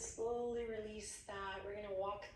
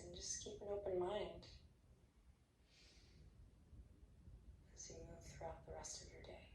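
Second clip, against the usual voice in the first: soft guided meditation; 8 dB quieter than usual. Acted. Yoga teacher ending class, hushed meditation voice. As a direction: settle them gently into rest. Closing relaxation guidance.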